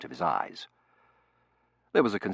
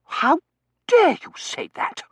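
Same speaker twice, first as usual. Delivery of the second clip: very high, loud, slow outrage; scandalised young lady's shriek; outraged; 10 dB louder, slower than usual. Acted. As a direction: draw out each affronted word at top pitch. Tossie affronted by the critique.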